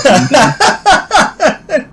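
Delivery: high laugh bursting out. Real, not acted. Comedian laughing about his drunken Andy Murray video. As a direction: laugh as you recall it.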